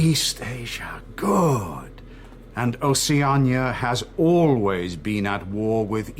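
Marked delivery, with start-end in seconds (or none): 0.0-2.0 slow hushed whisper; whispered approval. Acted. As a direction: breathe the words out with cold satisfaction. Interrogator savoring the answer.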